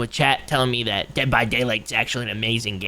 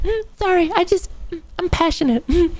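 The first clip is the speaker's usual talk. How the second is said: very high, small put-on voice; squeaky apology. Streamer excusing the outburst to chat, high squeaky apology. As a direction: squeak out a mock-sheepish apology.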